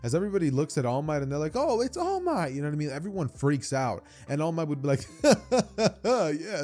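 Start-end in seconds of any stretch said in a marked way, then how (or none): 1.5-2.5 very high, fangirling exclamation; hammed-up excited crowd voice; mock starstruck. Class freaks out at hero. 5.2-6.6 loud, high, theatrical ha-ha-ha; put-on booming laughter; hammed-up hero laugh. All Might's hero laugh, acted out.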